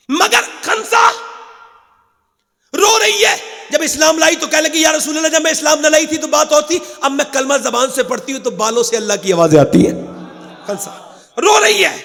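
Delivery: a preacher crying out over the weeping mother; impassioned preaching. Acted. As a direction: cry it out to the congregation. Preacher tells of Khansa weeping.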